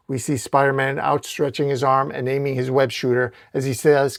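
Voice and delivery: ordinary delivery, nothing marked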